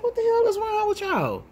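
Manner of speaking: high-pitched, indignant retort; indignant confrontation. Retold or imitated. Woman confronting people who challenged her, indignant.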